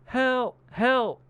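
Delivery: high, loud separated calls; imitated cry for help. Imitating a trapped man calling from underground, cry for help. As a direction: call up as if trapped below.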